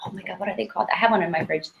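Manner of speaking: ordinary talk, nothing marked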